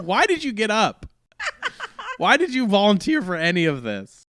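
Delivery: high, loud, incredulous protest; mock exasperation. Patient challenging the volunteer nurse, loud mock exasperation.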